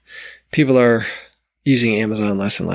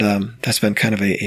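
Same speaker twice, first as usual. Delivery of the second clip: ordinary talk, nothing marked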